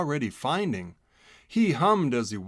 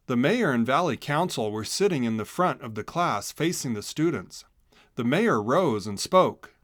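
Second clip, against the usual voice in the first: usual speaking voice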